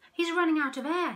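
high, loud panic; panicked. Acted. Pufferfish panicking over the diver, loud. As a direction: gasp out the danger. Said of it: "said a pufferfish".

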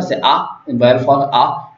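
ordinary talk

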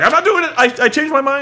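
high, loud panicky imitation; mock cowardly retreat. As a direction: yelp it like a hammed-up coward. Swagger backing out, acted.

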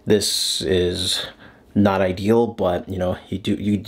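ordinary talk

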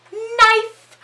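one word yelled very high and loud; high, loud exclamation; sudden startling shout. Retold or imitated. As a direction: burst out with it like a jump scare. Sudden stab breaks the crawling scene.

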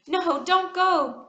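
slow, high and loud pleading cry; frightened cry. A narrator's reading. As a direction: beg her not to leave you hanging. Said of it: "cried Paul".

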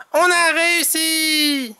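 high, drawn-out cheer of success; jubilant Tom. A narrator's reading. Tom celebrates the success, high jubilant cry. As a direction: celebrate high, stretching the last word. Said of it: "annonce Tom".

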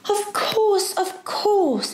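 very high, eager exclamation; exclaiming. A narrator's reading. Girl agreeing to the professor's wish, exclaiming. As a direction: jump in eagerly, pitch leaping up. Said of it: "exclaimed Diana".

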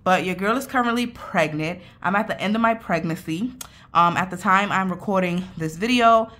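usual speaking voice

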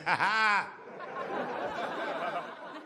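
put-on confident laugh; long drawn-out mock laugh. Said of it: "Put a confident laugh behind it".

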